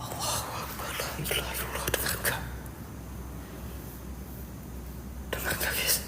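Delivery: hushed prayers and questions barely breathed; praying in a whisper, afraid of the crying voice; frightened whisper. Real, not acted. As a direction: keep it to a scared whisper throughout.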